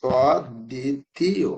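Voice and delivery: অদ্বিতীয় spelled out slowly with doubled দ, falling; very slow model word. Shown as a demonstration. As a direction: draw out অদ্বিতীয় for the class. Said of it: "কি হবে এটা". (অদ্বিতীয় খুব ধীরে, দ্ব জোর দিয়ে)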